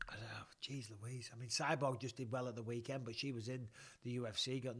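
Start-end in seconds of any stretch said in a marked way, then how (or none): 0.1-1.3 whispered. Real, not acted. Stumped by a fan question.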